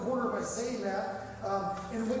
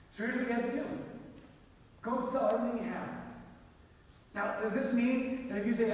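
ordinary delivery

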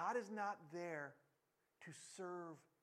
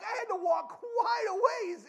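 high, loud, drawn-out complaint; hammed-up exasperated shout; 13 semitones higher, 12 dB louder, slower than usual. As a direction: stretch it out in loud indignation. Exasperated at the long walk.

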